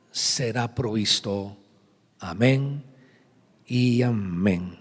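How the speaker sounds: slow, measured Bible reading with long pauses; solemn scripture reading. Acted. Reads Bible verse aloud.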